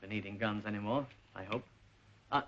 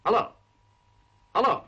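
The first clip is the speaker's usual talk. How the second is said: raised, urgent hello into a dead line; calling down phone; 9 semitones higher, 9 dB louder than usual. Acted. Son on a cut-off phone call, calling out. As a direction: call out to a caller who has vanished.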